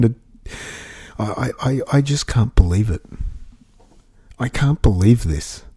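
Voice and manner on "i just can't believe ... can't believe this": ordinary talk, nothing marked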